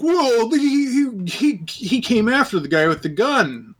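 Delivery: high, whiny mock excuse. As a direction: parody their lame excuse in a high voice. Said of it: "it was like".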